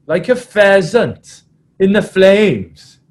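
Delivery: slow, loud, high mimicked rallying cry; Adam Jones. Prop climaxing his half-time talk, slow mimicked roar. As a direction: draw out the prop's big climax. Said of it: "it went something like this".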